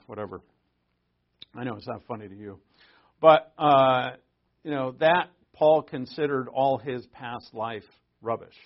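ordinary talk, nothing marked

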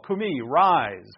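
normal talking voice

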